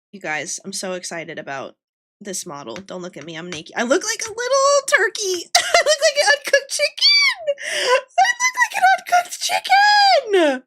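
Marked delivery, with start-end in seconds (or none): 3.8-10.6 high squeal through helpless laughter; cackling to tears. Real, not acted. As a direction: laugh so hard the words come out as a squealed wail. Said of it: "I'm cackling too, Sleepy. I'm crying".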